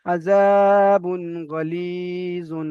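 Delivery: আযাবুন গলিজুন drawn out slowly in Arabic recitation style; আযাবুন গলিজুন in tajweed recitation; Quranic recitation. Shown as a demonstration. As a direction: recite আযাবুন গলিজুন with a long, careful melody. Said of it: "তানবিন নুন সাকিনের আওয়াজ একই রকম হয়, যেমন".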